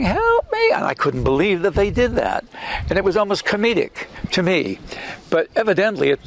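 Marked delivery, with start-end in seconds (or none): 0.0-0.7 very high, squeaky sped-up plea; tiny chipmunk-like cry for help; high-pitched fly voice. Retold or imitated. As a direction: mimic the studio's pitched-up soundtrack. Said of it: "it was high-pitched".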